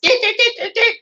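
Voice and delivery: very high repeated syllables; piping dick dick dick in different tones; imitating stroke patient. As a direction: mimic her one word in a high sing-song. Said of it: "she would go".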